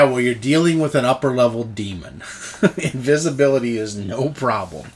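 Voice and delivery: normal talking voice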